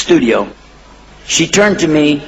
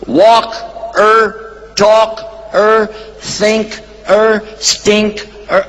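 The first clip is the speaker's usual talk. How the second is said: walk-er, talk-er, think-er, stink-er split with er stressed; 4 semitones higher, much slower than usual. Shown as a demonstration. Teaching the er suffix to the audience, stressing er. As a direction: pull each word apart and hit er. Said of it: "the one who does it".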